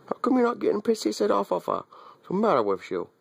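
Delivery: high squeaky teasing of the dog; high mock-scolding pet talk. Real, not acted.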